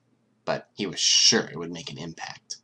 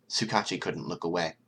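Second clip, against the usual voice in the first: ordinary delivery, nothing marked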